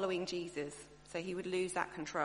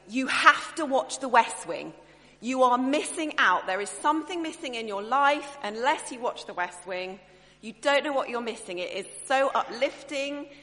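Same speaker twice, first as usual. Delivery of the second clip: much louder, higher, gushing sales pitch; enthusiastic pleading; 3 semitones higher, 11 dB louder than usual. Retold or imitated. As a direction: beg friends to watch The West Wing, all-out loud. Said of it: "And we're going".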